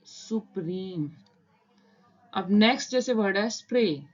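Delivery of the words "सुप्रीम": सुप्रीम stretched slowly and softer, each sound drawn out; slow read-out. Shown as a demonstration. Teacher decoding the outline for beginners, slowly and softly. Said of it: "तो ये word बन जाएगा".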